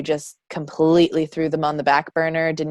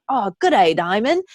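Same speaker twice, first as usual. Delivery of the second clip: imitated Australian greeting, higher and swooping; Australian accent; 5 semitones higher than usual. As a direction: put on an Australian relative's accent. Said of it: "and they're like".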